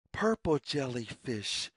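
usual speaking voice

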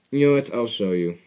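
ordinary delivery

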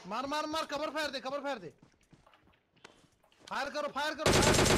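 high urgent shout for cover. Real, not acted. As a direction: yell for cover fire. Calling for cover fire. (ऊँची आवाज़ में cover fire की पुकार)

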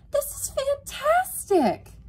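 very high, loud, drawn-out squeal; excited squeak. A narrator's reading. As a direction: squeak it slowly with huge delight. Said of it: "Yvonne squeaked".

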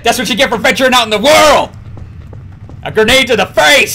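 high, loud triumphant yell; gloating shout. Real, not acted. Taunting friend he just blew up, gloating shout. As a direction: crow it over your victim. Gloating after grenade kill.

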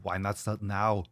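put-on slow, thick Austrian-accented action hero; drawn-out Austrian strongman impression; Arnold Schwarzenegger. Streamer replays a viewer's line as a trainer, Arnold impression. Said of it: "Arnold voice".

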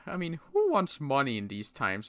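ordinary talk, nothing marked

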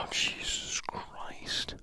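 slow whispered curse; muttering in whispered frustration at the game; exasperated whisper. Real, not acted. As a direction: breathe it out in disbelief.

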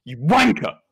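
punched, mock British insult; British swearing. Shown as a demonstration. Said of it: "British accents shouting British swear words".

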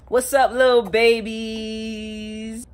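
slow, drawn-out loud hello; excited greeting. Acted. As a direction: stretch it out like a big show opener. Vlog intro greeting, stretched.

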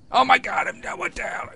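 loud, high-pitched shock; startled outburst. Real, not acted. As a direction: cry out in sudden alarm. Startled while playing the game.

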